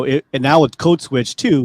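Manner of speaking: ordinary talk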